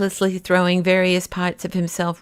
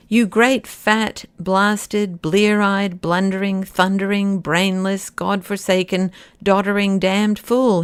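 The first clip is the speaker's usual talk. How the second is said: plain reading voice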